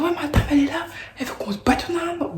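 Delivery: pleading tattletale to the teacher; tattling to teacher. Retold or imitated. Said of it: "j'ai fait la poucave". (elle balance à la prof, voix geignarde très aiguë)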